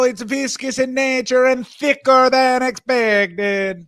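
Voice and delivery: high, slow mock connoisseur; hammed-up wine critic, high and slow; snooty taster. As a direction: savor each word like a pompous taster. Mock wine taster describing the drink.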